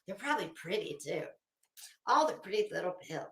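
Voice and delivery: sarcastic aside. Acted. Mocking Betty's pills, a fast soft sarcastic aside. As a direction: toss it off quick and quiet, dripping sarcasm.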